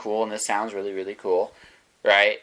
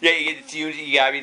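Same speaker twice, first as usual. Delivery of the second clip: normal talking voice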